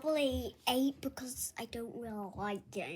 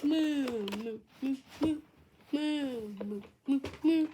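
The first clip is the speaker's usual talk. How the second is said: playful mooing; 4 dB louder than usual. Pretend. Child keeps making cow noises.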